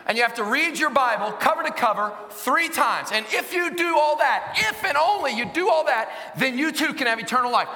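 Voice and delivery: loud, high-pitched preaching. Acted.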